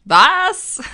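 loud, high shocked outburst. Real, not acted. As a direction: blurt it out in disbelief. Shocked she hasn't watched it.

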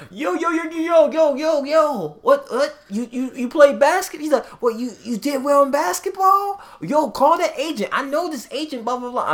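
very high, loud, excited "yo yo yo"; squeaky hyped-up roommate rattling on; shrill overexcited sidekick mocked; hyper roommate voice. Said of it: "who's pretty much was like".

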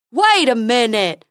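loud, slow dopey exclamation; alarmed silly unicorn. A narrator's reading. Unicorn realises Dentina's destination, loud silly alarm. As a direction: halt the goodbye in alarm.

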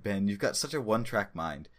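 usual speaking voice

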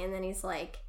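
ordinary talk, nothing marked

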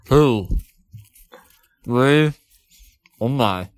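slow, muffled counting through a stuffed mouth; mouth full of marshmallows. Real, not acted. Podcast host counting marshmallows into his mouth, muffled and mouth-full. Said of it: "jam some jet puffed mallows in my mouth".

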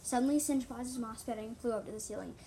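usual speaking voice

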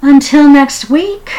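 high, loud bright farewell; upbeat show close; cheerful sign-off. Real, not acted. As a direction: sign off with a flourish. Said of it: "sign-off".

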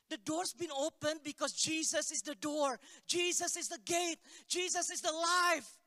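high, ringing proclamation, building to LIFE; fervent preaching. Real, not acted. Preacher tells congregation the door is open, fervent proclamation. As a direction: drive each repeated phrase home, pitch held high.

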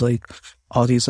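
ordinary talk

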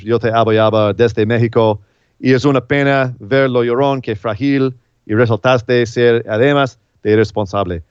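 reading the tweet "Yo te abo" in Spanish, halting. Shown as a demonstration. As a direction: read "irresponsable" out in Spanish. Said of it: "I'm not even trying to read it in Spanish".

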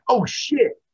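loud, very high burst of mock shock; acted sudden realization. Liam Neeson's character catching the clue with the viewer, acted shock. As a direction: exclaim it as if the penny just dropped.

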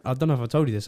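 ordinary talk, nothing marked

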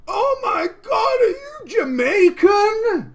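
high, slow, screeching oh my god; shrill shocked idiot voice. Acted. Raid leader voicing the oblivious tank, high shocked shriek. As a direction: squeal it out like a clueless shocked guy. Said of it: "a good voice for him".

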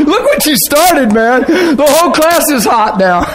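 high, slow words through laughter; laughing. Real, not acted. Preacher teasing the man at the thermostat, laughing. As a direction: talk while cracking up.

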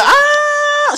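loud, very high mock scream; imitated holler. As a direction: scream as if tased, playfully overdone. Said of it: "And she hollered".

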